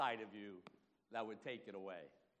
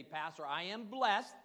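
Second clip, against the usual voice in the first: higher, louder, slowed proclamation; proud, raised-voice testimony; bold declaration; 7 semitones higher, 8 dB louder, slower than usual. Acted. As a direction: declare it to the pastor, drawn out. Models a believer's bold declaration.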